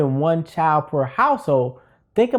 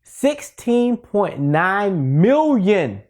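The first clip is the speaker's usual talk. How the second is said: "Sixteen point nine million" very slowly, word by word; drawn-out big number; much slower than usual. Real, not acted. Teacher relishing that number, "Sixteen point nine million" drawn out. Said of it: "I just like that number".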